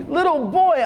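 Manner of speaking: exclaimed. Acted. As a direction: exclaim in delight at the boy at the door. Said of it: "Amu exclaimed".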